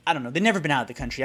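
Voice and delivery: ordinary talk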